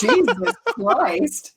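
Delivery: high, drawn-out exclamation of disbelief; real shock. Real, not acted. Friend reacting to a mother's morbid ashes wish, shocked and drawn-out. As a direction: stretch it out slowly, stunned and high.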